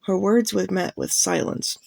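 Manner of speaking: usual speaking voice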